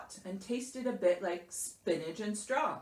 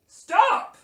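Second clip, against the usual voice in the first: loud, high outburst from grumpy Bear; growled order; 14 semitones higher, 14 dB louder than usual. A narrator's reading. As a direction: cut Mouse off with a bear's growl. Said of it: "growled Bear".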